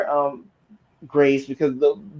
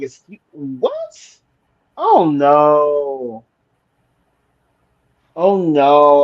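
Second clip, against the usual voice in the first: shocked disbelief; 3 semitones lower, 3 dB louder, much slower than usual. Retold or imitated. Co-host reacting to Amy's attitude, stunned disbelief. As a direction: drag it out, aghast. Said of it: "I'm like".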